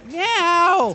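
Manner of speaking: loud, high hungry meow; imitating a demanding cat; yowling cat. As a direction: yell it like a starving cat. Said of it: "and just yell at me".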